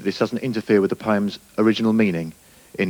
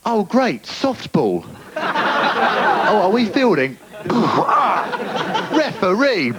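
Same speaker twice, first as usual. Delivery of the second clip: cheerful seal voice; 11 semitones higher, slower than usual. Pretend. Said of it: "It's like".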